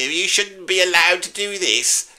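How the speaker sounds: high, slow whiny complaint. Pretend. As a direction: moan it like a sulky fan. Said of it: "read it out in that whiny voice".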